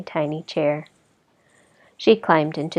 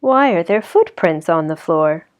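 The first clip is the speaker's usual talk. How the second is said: usual speaking voice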